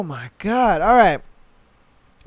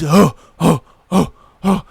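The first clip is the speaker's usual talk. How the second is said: mock grunting; 4 semitones lower, 4 dB louder than usual.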